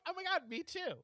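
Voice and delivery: high-pitched mock excitement; mock gushing. Eavesdropper mocking the podcaster on the train, mock gushing. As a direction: pitch it way up, feigned delight. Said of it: "wanted to be like".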